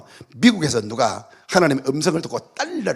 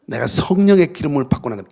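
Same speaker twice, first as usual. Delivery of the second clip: normal talking voice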